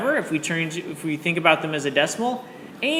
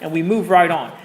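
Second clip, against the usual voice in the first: higher-pitched, hammed-up British accent, slipping towards Australian; terrible British accent; 4 semitones higher than usual. Shown as a demonstration. Teacher fulfilling a promise to her class, terrible British accent. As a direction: put on a bad British accent. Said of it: "tell this story in a British accent".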